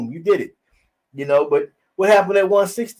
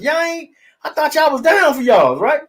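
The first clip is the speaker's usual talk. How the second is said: incredulous; 8 semitones higher than usual. Retold or imitated. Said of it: "I'm looking around mesmerized".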